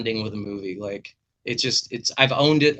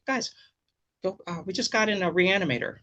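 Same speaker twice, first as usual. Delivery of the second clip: higher, odd put-on voice of a shady clerk; weird voice; 7 semitones higher, 3 dB quieter than usual. Video store clerk pitching Reanimator to kids, lightly done weird voice. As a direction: mimic a strange video store guy. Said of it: "he had kind of a weird voice like this".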